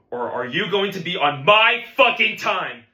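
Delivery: slow, loud, rising menace; angry threat. Acted. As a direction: lean hard on each word. Teacher threatening drummer.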